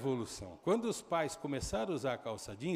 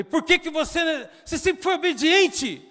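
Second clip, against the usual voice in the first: acted desperate shout; 14 dB louder than usual. Desperate father pleading shout.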